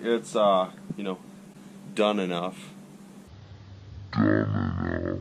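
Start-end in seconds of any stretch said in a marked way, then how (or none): 4.1-5.2 much lower, slowed-down voice; deep slow echo. Pretend. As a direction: drag it out low and heavy. Repeats the phrase, deep.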